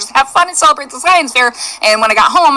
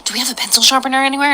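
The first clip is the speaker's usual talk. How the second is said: whispered so class won't hear; hushed aside to Mom; whispering; 4 dB quieter than usual. Retold or imitated. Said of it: "she goes".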